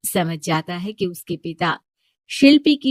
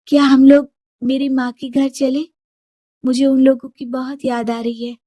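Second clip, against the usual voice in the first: lightly voiced wife, slightly higher; young wife asking; 6 semitones higher, 3 dB louder than usual. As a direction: asks gently to visit her mother. Shilpi asks husband to visit mother.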